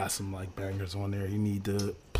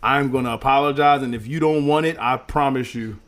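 ordinary talk

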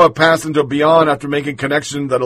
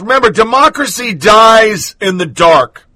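higher, louder mocking shout of the slogan; sarcastic shout; 5 semitones higher, 4 dB louder than usual. Host mocking the newspaper's motto, sarcastic shout. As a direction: throw the slogan back at them.

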